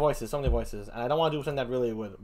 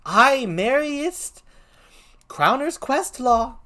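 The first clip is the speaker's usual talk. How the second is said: high, drawn-out clown reply; high-pitched comic Shakespeare voice; first clown; 9 semitones higher, 8 dB louder, much slower than usual. Acted. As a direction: answer as a cocksure gravedigger.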